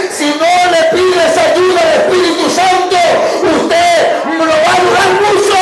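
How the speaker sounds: full-force preaching. Real, not acted. Pastor warning his congregation to seek the Holy Spirit, full-force preaching. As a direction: cry the warning out to the congregation, slowing on the end.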